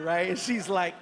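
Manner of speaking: ordinary talk, nothing marked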